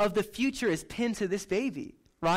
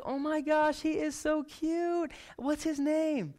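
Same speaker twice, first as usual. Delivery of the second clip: put-on high, slow gushing over a cute baby; cooing at a baby; 8 semitones higher, slower than usual. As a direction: coo like a stranger meeting a baby in Aldi. Stranger coos over baby in store.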